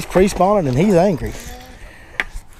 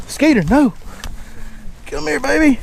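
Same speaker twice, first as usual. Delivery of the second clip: calling the dog; 11 semitones higher than usual. Real, not acted.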